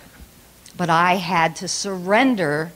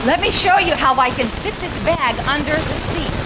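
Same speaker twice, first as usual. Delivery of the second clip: raised, insistent voice; mildly raised pastor, showing off his folded bag; 6 semitones higher than usual. Retold or imitated. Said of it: "I raised my voice a little bit".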